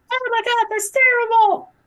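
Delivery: very high, loud mock squeal; horrified tourist. Tourist hearing about drop bears, hammed-up horror.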